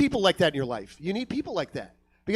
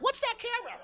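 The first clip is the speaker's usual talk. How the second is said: very high, soft goofy wondering; mock curiosity; 8 dB quieter than usual. Comic spotting a film camera while eating, high mock curiosity. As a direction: squeak it out softly.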